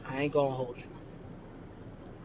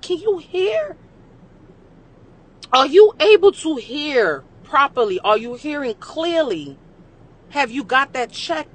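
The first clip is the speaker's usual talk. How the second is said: sarcastic; 7 semitones higher than usual. Retold or imitated. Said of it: "I said".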